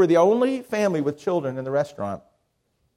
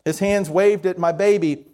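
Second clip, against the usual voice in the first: normal talking voice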